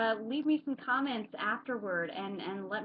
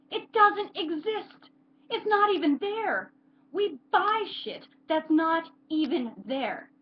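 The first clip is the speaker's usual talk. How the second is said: high, loud, slow cry of despair; outraged climax; 8 semitones higher, 10 dB louder, slower than usual. Acted. Character railing that what we buy isn't there, anguished outburst. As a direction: hammer it out slowly at the top of your voice.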